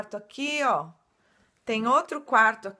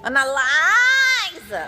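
excited shout; 5 semitones higher, 10 dB louder, much slower than usual. Acted. Announcing a guest to viewers.

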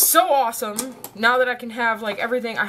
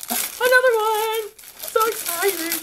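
sing-song slow and thrilled; excited coo; 13 semitones higher than usual. Real, not acted. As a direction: coo over it with delight. Second gift, cooing.

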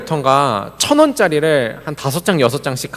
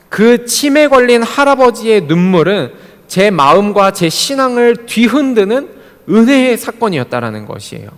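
raised, high-pitched pulpit delivery; emphatic preaching; 7 semitones higher, 5 dB louder than usual. Real, not acted. Pastor explaining the grandfather's tears to the congregation, emphatic preaching. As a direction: drive the point home to the congregation.

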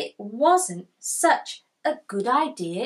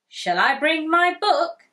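higher, louder hopeful ask; eager Kipper; 6 semitones higher, 5 dB louder than usual. Acted. Kipper asking Tiger about the book, hopeful. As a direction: ask brightly to bring the book. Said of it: "said Kipper".

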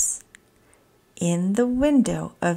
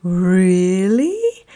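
surprised gasp; 4 dB louder than usual. Acted. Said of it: "Oscar gasped".

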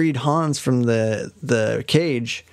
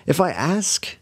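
put-on little girl voice; 6 semitones higher than usual. Gretel voiced, far timbre.

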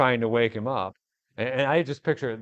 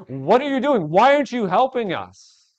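high, loud demanding questions; angry accusation; 8 semitones higher, 6 dB louder than usual. Acted. Disciples accusing Jesus, high and angry. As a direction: demand answers in frustration. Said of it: "That's the tone of their question".

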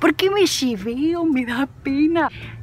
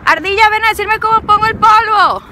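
llamando a la ardilla; 8 semitones higher, 8 dB louder than usual. Pretend. Le habla a la ardilla.

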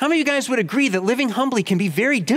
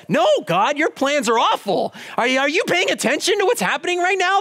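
frustrated complaint to God; 6 semitones higher than usual. Retold or imitated. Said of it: "That's how I felt".

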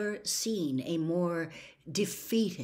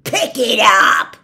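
furious bellow, much louder, slow; 4 semitones higher, 16 dB louder, slower than usual. A narrator's reading. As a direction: roar the order at her like a brute. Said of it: "Gaunt bellowed at her".

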